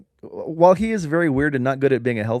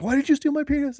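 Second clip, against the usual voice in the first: much higher, frantic accusation; mock frantic squeal; freaking out. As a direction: shriek the question at him. Voiced victim in big fight.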